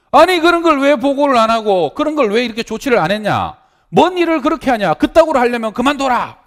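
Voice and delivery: high, loud, enraged boss shout; furious scolding. Retold or imitated. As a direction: tear into the staff pastor. Senior pastor furious at staff.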